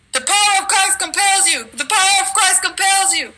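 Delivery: high, ringing exorcist command; preacher casting out a devil; commanding exorcism. Retold or imitated. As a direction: cast the demon out with full authority. Commanding a demon in dream.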